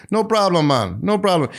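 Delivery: high, lilting Jamaican catchphrase; imitated Jamaican accent. Quoting a local explaining a Jamaican saying, imitated Jamaican accent. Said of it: "When you hear Jamaicans say".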